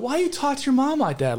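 high-pitched mock objection; mock disapproval. Outsiders questioning the son, mock disapproval.

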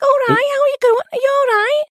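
very high, exaggerated Scouse accent; Liverpool accent. Shown as a demonstration. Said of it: "suddenly everyone's like that".

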